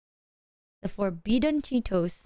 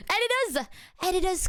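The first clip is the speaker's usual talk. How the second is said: high call to the editors; 7 semitones higher than usual. Real, not acted. Calling out to editors.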